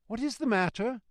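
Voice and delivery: high, timid passenger voice; mildly quavering. A narrator's reading. Passenger to messenger, high mild quaver. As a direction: ask anxiously, pitched well up. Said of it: "with mildly quavering speech".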